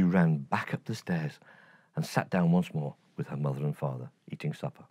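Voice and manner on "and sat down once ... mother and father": ordinary delivery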